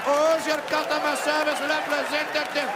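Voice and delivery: put-on loud, high Indian accent. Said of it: "I'm Indian".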